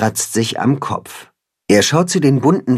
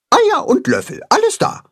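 very high-pitched hen voice; flower hen. A narrator's reading. The flower hen confirms eggs and spoons, hen voice. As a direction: report it high, as a little hen. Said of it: "antwortet das Blumenhuhn".

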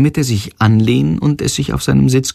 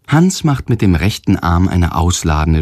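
usual speaking voice

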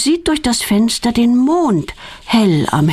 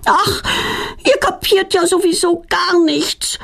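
yelling back; 11 semitones higher than usual. A narrator's reading. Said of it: "brüllt Petronella zurück".

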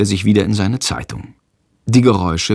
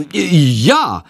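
awkward throat-clear, then a higher answer; 7 semitones higher than usual. A narrator's reading. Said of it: "Jendrik räuspert sich". (sich räuspernd, verlegen)

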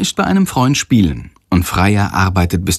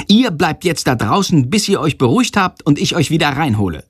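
higher, irritated telling-off; teacher's scolding; 7 semitones higher than usual. A narrator's reading. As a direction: lay down the punishment briskly. Angry teacher sends boys out. (aufgebrachter Lehrer, hoch und streng)

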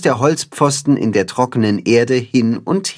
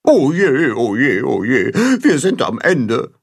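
mildly hammed-up goose wail; Gram, despairing. A narrator's reading. Goose Gram gives up over the sign, despairing. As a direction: moan in a falling run, then rise in despair. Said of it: "winkt Gram verzweifelt ab".

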